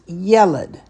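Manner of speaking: "yeled" said YEH-led, slowly; stress on first syllable. Shown as a demonstration. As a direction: punch the "ye" in "yeled". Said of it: "So this word is pronounced".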